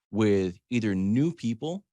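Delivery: normal talking voice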